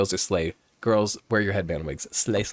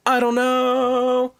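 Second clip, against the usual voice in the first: drawn-out shrug; 6 dB louder, much slower than usual. Real, not acted. Airy shrug after a rambling argument.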